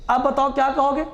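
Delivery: normal talking voice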